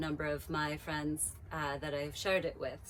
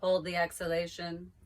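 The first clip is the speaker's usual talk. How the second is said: reciting a mantra; 2 semitones higher, 3 dB louder than usual. Acted. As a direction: let each phrase land, then wait. Recites mantra with long pauses.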